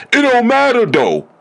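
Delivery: high, huffy mock voice dismissing the point; petulant comeback. Offended person brushing off the truth, in a high huffy mock voice. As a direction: play the offended one, whining about tone.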